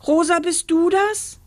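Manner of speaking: put-on high, thin boyish call. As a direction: call up in a small voice. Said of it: "schrie eine dünne Stimme".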